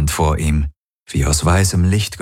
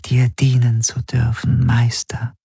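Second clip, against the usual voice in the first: higher whispered devotion; tender whisper; 5 semitones higher than usual. A narrator's reading. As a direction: whisper it to your master. Tender farewell words.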